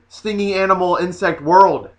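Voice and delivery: ordinary talk, nothing marked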